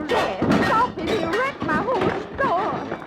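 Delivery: high, loud panicked cry for help; frightened plea. Acted. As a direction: beg him to stop the fight, alarmed. Shopkeeper pleads during a brawl.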